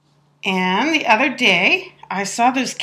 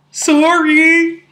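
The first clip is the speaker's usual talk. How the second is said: put-on a louder, higher, meek kid's sorry; sheepish kid; 7 semitones higher, 8 dB louder than usual. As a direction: mumble an apology like a caught child. Kid apologizes in retold scene.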